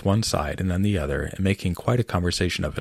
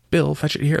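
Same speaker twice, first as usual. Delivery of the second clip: calling out; 9 semitones higher, 4 dB louder than usual. Acted. Workers calling across the yard.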